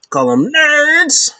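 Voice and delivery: high, drawn-out mocking heckle; Carl Anderson. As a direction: stretch the word out high, imitating a wrestler's taunt. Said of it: "They're like Carl Anderson".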